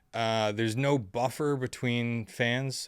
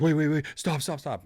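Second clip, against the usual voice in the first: frantic yell; 6 semitones higher, 6 dB louder than usual. Retold or imitated. Hat guy running in, yelling.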